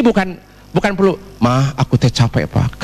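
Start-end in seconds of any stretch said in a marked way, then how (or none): 1.4-2.8 low, worn-out mock crying wife; tearful weary wife. Wife tells husband she is tired, weary and tearful. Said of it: "Kenapa sih, Mah, kamu nangis?".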